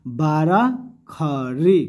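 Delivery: बारह खड़ी drawn out slowly, rising at the end; slow emphasis. Shown as a demonstration. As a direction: stretch out बारह खड़ी so the class remembers it. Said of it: "याद रखिए". (धीरे और ज़ोर देकर बारह खड़ी)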